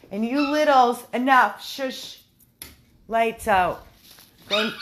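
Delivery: loud scolding to noisy dogs. Real, not acted. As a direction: order the dogs to settle.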